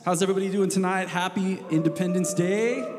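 loud, high, bright stage greeting; in front of an audience. Real, not acted. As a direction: call out to the whole room. Pastor greets congregation from stage.

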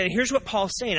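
ordinary talk, nothing marked

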